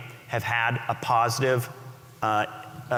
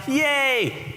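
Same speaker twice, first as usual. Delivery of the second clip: mock cheer; 12 semitones higher than usual. Audience cheering the smartphone.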